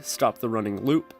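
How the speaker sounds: ordinary talk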